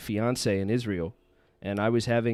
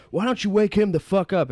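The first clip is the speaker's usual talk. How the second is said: high, loud angry yell; retold shout; 11 semitones higher, 8 dB louder than usual. Guy on the trip yelling to wake a sleeping friend, loud angry shout. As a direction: bark the order across the room. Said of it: "he was just shouting out".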